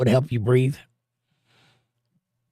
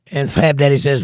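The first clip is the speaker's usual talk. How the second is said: ordinary talk, nothing marked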